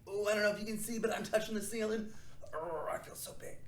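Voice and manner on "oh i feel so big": whisper. Pretend. Pretend whisper during stretch.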